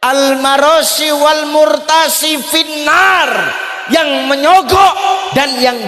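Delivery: slow, high, loud recitation; emphatic hadith. Real, not acted. Preacher declaiming the bribery hadith to the crowd. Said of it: "quoting hadith loudly".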